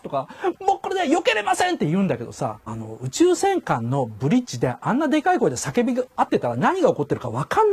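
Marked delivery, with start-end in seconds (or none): 0.6-1.8 high, far-off shouted report like an anime crew; mock anime bridge shout. Mocking anime crew member shouting a missile warning. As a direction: ham up a bridge officer yelling the alert.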